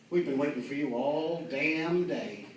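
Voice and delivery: slow, dry cop drawl. Retold or imitated. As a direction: stretch it out slowly. Cop jokes at speeder.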